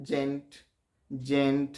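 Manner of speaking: Gent slowly as one unbroken syllable; slow single beat. Shown as a demonstration. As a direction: draw out gent without splitting it. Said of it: "আস্তে উচ্চারণ করলেও দেখো একটাই". (Gent আস্তে, একবারে)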